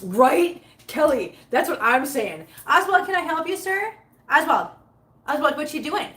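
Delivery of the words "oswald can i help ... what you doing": talking to the cat. Real, not acted.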